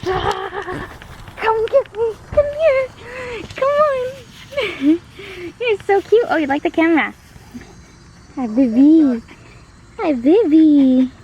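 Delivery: high, loud puppy calling. Real, not acted. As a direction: call the puppy in sing-song. Playing with puppy.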